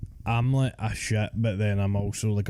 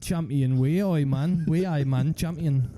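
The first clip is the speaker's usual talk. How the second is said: mock Geordie accent; 4 semitones higher than usual. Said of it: "Is that a Geordie accent?".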